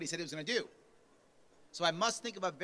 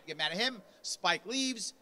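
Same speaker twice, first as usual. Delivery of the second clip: ordinary talk, nothing marked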